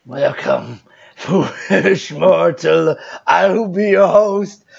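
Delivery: slow, low spooky host; ghost host voice. Acted. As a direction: greet them like a haunted house ghost. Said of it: "You're a ghost".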